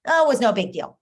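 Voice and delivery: imitating a daughter shrugging off her day; breezy daughter. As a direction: toss it off casually. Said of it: "she might say".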